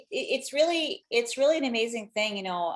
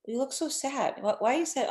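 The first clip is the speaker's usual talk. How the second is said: put-on higher, sing-song pity for a pouting child; cooing at her sad son; 5 semitones higher than usual. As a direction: coax the sad little boy gently, pitched up. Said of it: "I'm like, I'm like".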